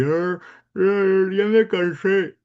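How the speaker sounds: high, slow, garbled speech round an obstruction; tongue blocked, straining to form the words; pencil under tongue. Shown as a demonstration. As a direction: demo talking with a pencil in the mouth. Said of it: "Sacas el lápiz y ahí empiezas".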